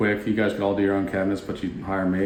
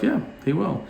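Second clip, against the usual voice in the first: much higher, quick yes; a higher, confident yeah; eager agreement; 10 semitones higher than usual. Retold or imitated. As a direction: agree with bright certainty. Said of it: "And I'm like".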